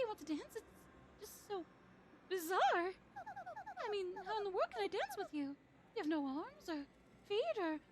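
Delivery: high flustered princess; giddy and flustered, way up high; Princess Peach voice. Acted. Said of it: "my Peach voice".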